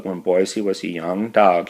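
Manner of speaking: plain reading voice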